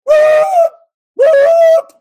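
two shrill high cartoon whoops; loud cartoon sound effect whoop. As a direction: yelp like a hat flying off in a cartoon. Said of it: "my hat would have done this today".

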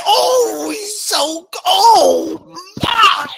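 high, loud, crying mock sob; a shocked churchgoer cries out in mock horror; mock gushing listener. Mock wailing cry. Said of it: "some of you would say".

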